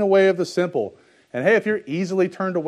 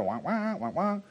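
Charlie Brown's teacher; 5 semitones higher, 8 dB quieter, slower than usual. Retold or imitated. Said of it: "like Charlie Brown's teacher".

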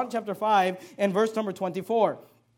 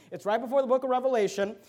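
ordinary delivery

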